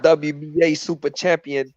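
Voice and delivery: ordinary talk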